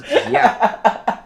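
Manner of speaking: high, loud burst of laughing; giggling a shameless yes at the guard; laughing yeah. Retold or imitated. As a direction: crack up mid-answer. Cheeky answer to security guard.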